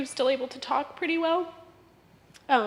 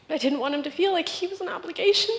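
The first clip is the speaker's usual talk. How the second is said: normal talking voice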